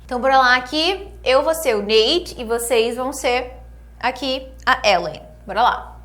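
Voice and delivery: clear, measured English lesson delivery; model dialogue reading. Acted. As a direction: read each line for them to repeat. Reads dialogue lines for students. (leitura de diálogo modelo)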